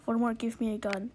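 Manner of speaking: usual speaking voice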